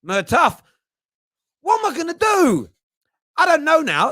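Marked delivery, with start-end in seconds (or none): 1.6-4.1 high, despairing What am I gonna do; comic exasperation. Real, not acted. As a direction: throw your hands up in mock despair. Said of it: "I'm confused now".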